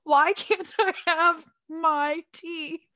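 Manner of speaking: slow, loud drugged pleading; mad whine. Retold or imitated. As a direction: stretch out each word in protest. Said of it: "I was so mad".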